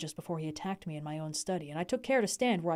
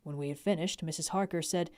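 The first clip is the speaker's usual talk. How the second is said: usual speaking voice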